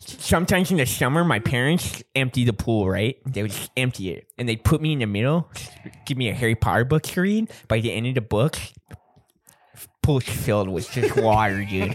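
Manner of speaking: slushy s sounds all through; lisped. Acted. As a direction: tell it with a thick fake lisp. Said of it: "like people with lisps".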